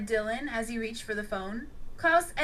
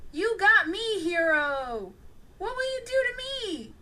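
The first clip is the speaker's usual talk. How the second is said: joking; 8 semitones higher, 4 dB louder than usual. A narrator's reading. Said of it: "joked Xavier".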